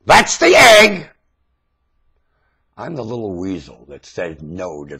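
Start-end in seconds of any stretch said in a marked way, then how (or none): 0.1-1.1 high, slow and loud; slow loud proclamation. Real, not acted. Ranter declares to the audience, slow loud cry. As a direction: hammer each word out.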